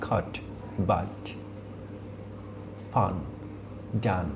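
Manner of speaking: খাট, বাট, ফান, ডান each with a short throat আ; short throat vowel. Shown as a demonstration. Teacher listing example words for the class. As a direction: model the short vowel word by word. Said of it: "উচ্চারণটা আপনার গলা থেকে আসছে".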